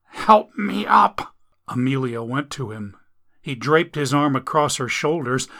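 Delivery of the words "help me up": higher, slow strained groan; injured man asking to be helped up; groaning plea. Acted. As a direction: groan it out in pain. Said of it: "Carsons groaned".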